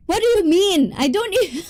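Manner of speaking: high-pitched baffled protest tipping into laughter; amused disbelief. Real, not acted. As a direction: protest in disbelief, about to crack up. Baffled by article, breaks into laughter.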